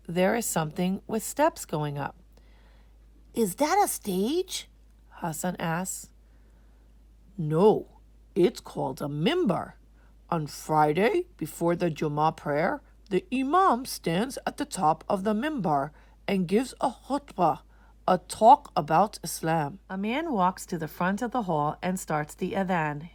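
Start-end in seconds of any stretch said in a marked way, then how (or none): 3.3-4.7 put-on higher, slower child question; curious little boy. Hassan asking Daddy about the mimbar, curious boy. 7.4-19.8 a hint of a firm, louder dad explaining; lightly voiced father. Daddy explaining the mimbar to Hassan, lightly voiced.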